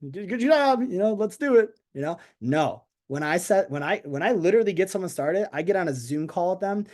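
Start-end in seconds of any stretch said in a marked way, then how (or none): 0.1-1.7 mock a careless upline brushing off a new recruit; mock cheery sendoff. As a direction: upline tossing off an empty good luck, high and cheery. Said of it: "they're like".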